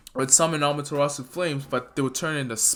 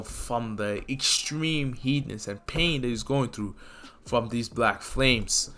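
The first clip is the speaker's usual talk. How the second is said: ordinary talk, nothing marked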